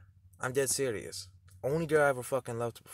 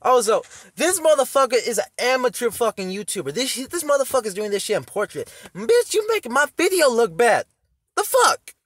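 high, loud indignant tirade; real irritated shouting; outraged rant; 11 semitones higher, 9 dB louder than usual. Real, not acted. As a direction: yell your complaint at a rival, pitch climbing. Angry rant at rival YouTuber.

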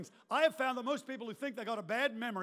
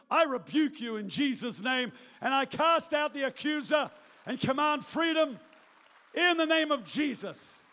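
loud, forceful command in prayer; preaching rebuke; 6 dB louder than usual. Real, not acted. Preacher rebukes condemnation over the congregation, forceful command. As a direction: cast it out with ringing authority.

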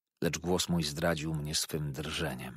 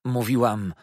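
loud, higher acted comeback; defiant retort; 5 semitones higher, 8 dB louder than usual. A narrator's reading. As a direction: throw it back at him forcefully. (głośna, wyzywająca riposta)

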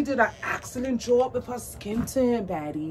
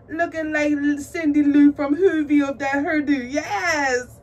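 high, loud gushing; excited hyping; 5 semitones higher, 7 dB louder than usual. Real, not acted. Admiring the doll's hairdo, excited hyping. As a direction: cheer it on loudly, ending in laughter.

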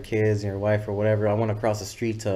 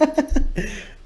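a sudden high-pitched burst of laughter; real laugh that jumps up high. Real, not acted. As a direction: break into a high giggle. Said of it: "Probably how I laugh right now".

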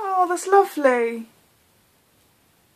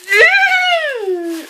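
very high, loud drawn-out squeal; long excited shriek; 13 semitones higher, 7 dB louder than usual. Real, not acted. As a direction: let out a piercing squeal of delight. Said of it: "Sorry for the noise".

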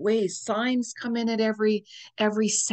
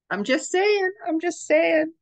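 teasing singsong; 10 semitones higher than usual. Real, not acted. Teasing a secret announcement.

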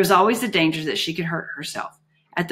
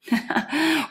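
short laugh; 9 semitones higher than usual. Acted. Juliet laughs at the request.

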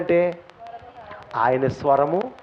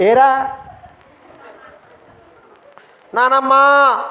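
mock call from home; 7 semitones higher, 4 dB louder, much slower than usual. Mimicking family interrupting prayer.

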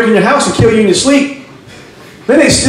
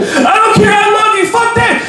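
very high-pitched possessive screech; clingy crazy girlfriend; 11 semitones higher, slower than usual. Acted. Girlfriend to boyfriend breaking up, high frantic refusal. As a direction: refuse the breakup in a mock female falsetto.

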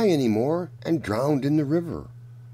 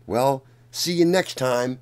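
ordinary delivery

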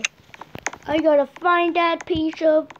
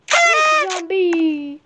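drawn-out, louder, higher cheer; excited cry; 4 semitones higher, 5 dB louder, much slower than usual. Real, not acted. Player hailing the brick zombie find, excited drawn-out cry. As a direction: stretch it out in a high, loud cry.

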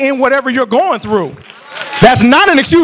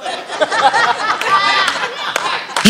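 real laugh; 7 semitones higher, 6 dB quieter than usual. Real, not acted. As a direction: burst of high laughter. Mocking the silent one.

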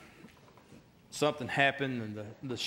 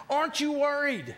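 very high, exasperated mock question; aggravated disbelief; 11 semitones higher, 4 dB louder than usual. Frantic castaway to his carefree companion, high and aggravated. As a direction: imitate a man beside himself with worry. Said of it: "he gets so aggravated".

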